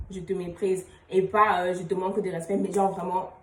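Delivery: normal talking voice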